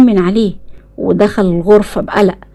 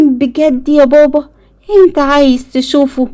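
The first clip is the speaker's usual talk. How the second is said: put-on excited child; 6 semitones higher than usual. A narrator's reading. Eyad voiced excited.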